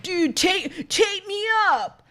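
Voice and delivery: 1 movie turtle. Retold or imitated. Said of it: "he's like".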